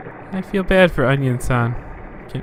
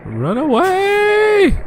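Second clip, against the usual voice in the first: high squeaky cry; much slower than usual. Acted. Voicing a character fleeing.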